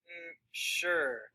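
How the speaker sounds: hesitate, then agree without really wanting to; reluctant. Shown as a demonstration. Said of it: "she's not really wanting to do this?".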